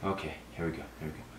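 very low, barely audible murmur; quiet self-coaching. Real, not acted. Player to himself before another try, quiet self-coaching. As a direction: softly psych yourself up.

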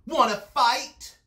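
high, loud dare; pugnacious bug challenge; grouchy ladybug. A narrator's reading. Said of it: "said the grouchy ladybug".

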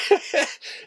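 short chuckle at own joke; self-amused chuckle. Real, not acted. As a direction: laugh briefly at yourself. Laughs at hint of own age.

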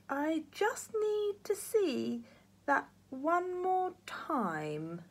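put-on high, very slow old man voice; sly ruse. A narrator's reading. Grandpa tries to fool the matron, high slow voice. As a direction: draw it out to trick her. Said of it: "ventured Grandpa".

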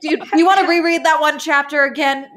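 high, mocking, incredulous questions; laughing sarcastic teasing; sarcastic disbelief. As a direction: needle the author with mock doubt. Mock sarcastic questions to author.

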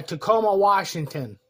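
ordinary talk, nothing marked

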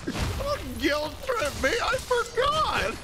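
high, slow whine; whiny protest. Real, not acted.

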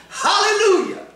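high, laughing cry of praise; joyful hallelujah. Real, not acted. Preacher exults before the church, laughing high hallelujah. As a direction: burst out in joy.

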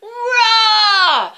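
very loud, high full roar; the dragon's almighty roar; mighty dragon roar. Acted. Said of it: "With an almighty roar".

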